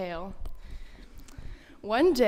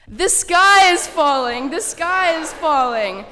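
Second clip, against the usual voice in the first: very loud, high, slow panicked yell; panicked scream; 7 semitones higher, 12 dB louder, slower than usual. Acted. Storyteller and children yelling that the sky is falling. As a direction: scream it slowly, all out. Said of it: "screamed as they ran".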